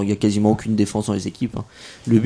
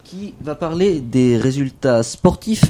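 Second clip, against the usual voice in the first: ordinary delivery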